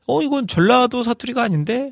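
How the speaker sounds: high-pitched puzzled questioning; mock puzzlement, high questioning tune; puzzled listener. Voices a puzzled listener hearing mixed dialect.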